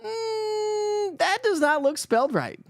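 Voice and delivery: high, doubting hum then a squint at the page; hammed-up baffled self-talk; puzzled inner voice. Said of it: "you're like".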